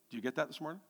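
ordinary delivery

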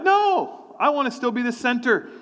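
very high mock refusal that eases down; self-centred protest. Chair two and three refusing to deny themselves, mock petulant.

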